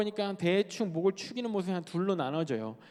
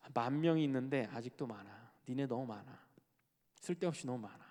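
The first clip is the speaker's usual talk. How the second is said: low, soft acted pronouncement; understated verdict; 5 semitones lower, 6 dB quieter than usual. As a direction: say it low and quietly. 하나님 말씀을 낮게 연기. (낮고 조용하게 타이르듯)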